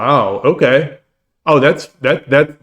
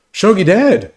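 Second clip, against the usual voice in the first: higher, eager fan gushing; admiring fan; 8 semitones higher than usual. Pretend. Imagined fan on the street greets Shogi Dad, higher and eager. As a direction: gush like a starstruck fan spotting him. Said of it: "They'll be like".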